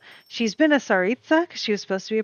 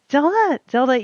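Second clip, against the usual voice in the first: calling the dog; 7 semitones higher, 7 dB louder than usual. Real, not acted.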